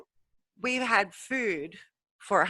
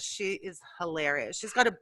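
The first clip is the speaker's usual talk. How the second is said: usual speaking voice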